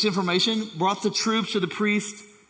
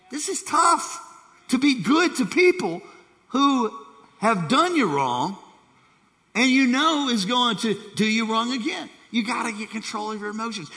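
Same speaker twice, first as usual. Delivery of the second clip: impassioned preaching; 4 semitones higher than usual. Real, not acted. Preacher to congregation, high and impassioned. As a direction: lift it up like a plea.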